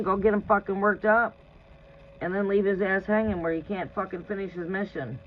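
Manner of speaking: usual speaking voice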